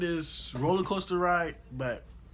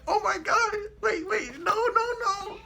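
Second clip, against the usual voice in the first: high falsetto, laughing panic; mock panic; 5 dB louder than usual. [name] clutching his pockets on the ride, high laughing panic. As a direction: squeal in fake fear. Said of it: "So I'm like".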